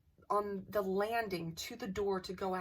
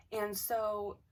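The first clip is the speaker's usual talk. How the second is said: usual speaking voice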